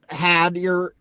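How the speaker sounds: ordinary talk, nothing marked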